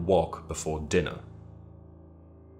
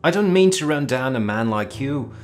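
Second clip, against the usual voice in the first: put-on breezy young officer; 9 semitones higher than usual. A narrator's reading. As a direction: rattle on like a carefree cavalry officer. Lewis disparages Wilde.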